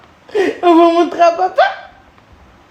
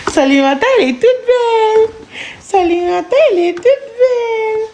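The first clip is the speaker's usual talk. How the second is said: put-on high child voice; 7 semitones higher than usual. Mother voicing child, repeated praise.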